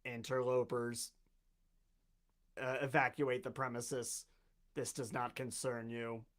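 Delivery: low, soft, slow robot command. Acted. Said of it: "it turns towards you and it says".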